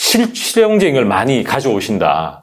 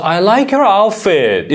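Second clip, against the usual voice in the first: slow demo; 6 semitones higher, much slower than usual. Shown as a demonstration. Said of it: "따라서".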